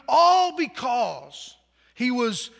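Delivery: normal talking voice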